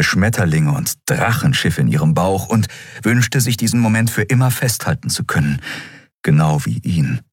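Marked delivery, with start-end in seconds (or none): none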